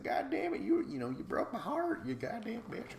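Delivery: very high mock sobbing wail; hammed-up blubbering breakup voice; mock crying. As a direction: imitate a man crying on the phone. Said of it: "He cries".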